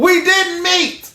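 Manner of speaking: emphatic slow repeat; sound bite delivery. Shown as a demonstration. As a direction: drag out "We didn't meet." for emphasis. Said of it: "let that be the sound bite".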